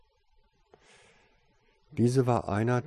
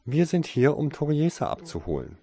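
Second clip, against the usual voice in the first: a higher-voiced woman at the door; high female voice; 8 semitones higher, 4 dB louder than usual. A narrator's reading. Woman outside the door, higher voice. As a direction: lift into a woman's voice. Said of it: "eine recht hohe weibliche Stimme".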